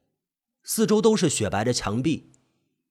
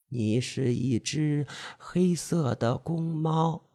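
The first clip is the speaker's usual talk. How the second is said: slower, softer hypnotic repeat; seductive hypnotic voice; 6 dB quieter, much slower than usual. Acted. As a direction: draw out 黑 in a soft, slow murmur. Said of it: "魅惑的声音， 细声慢语".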